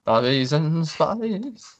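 a hammed-up rock-star croon. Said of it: "my [name] impression".

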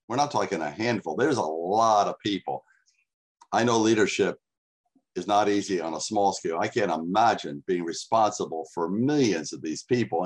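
normal talking voice

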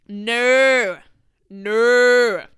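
loud, firm no; loud refusal. Real, not acted. Refusing the idea outright, loud firm no. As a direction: shut it down loudly.

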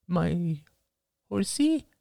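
put-on high child voice rising; boy asking about his toy, high and hopeful; hopeful little boy. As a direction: plead like a toddler.

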